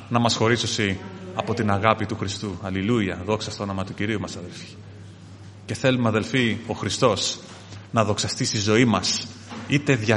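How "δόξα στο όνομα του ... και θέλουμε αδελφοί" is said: normal talking voice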